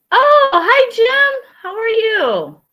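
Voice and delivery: high, loud, delighted hello; excited greeting. Real, not acted. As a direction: greet him with a burst of joy. Surprised greeting of a viewer.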